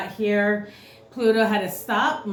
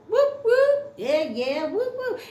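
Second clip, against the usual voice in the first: party cheer; 12 semitones higher, slower than usual. Pretend. Celebrating planets bumping together.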